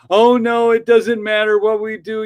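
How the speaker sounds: high, loud mock wail of despair; hammed-up hopeless whine; mocking whiny doomer. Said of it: "you're acting like battered wives".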